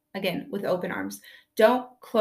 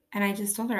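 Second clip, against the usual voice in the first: normal talking voice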